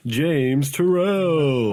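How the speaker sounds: guest introduction. Real, not acted. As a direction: stretch the name out like a ring announcer. Announcing the first guest with fanfare.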